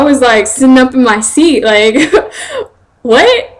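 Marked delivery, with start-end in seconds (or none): 3.0-3.6 high-pitched, louder startled "what"; retold shock breaking into laughter; mock startled. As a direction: pop up in amused disbelief. Acting out startled reaction in class.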